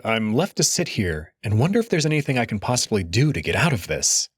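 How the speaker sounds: usual speaking voice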